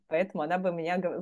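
ordinary delivery

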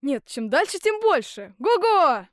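loud, high and gleeful, rising to a holler; excited shout. A narrator's reading. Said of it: "опять закричал Ганс Чурбан". (громкий ликующий возглас)

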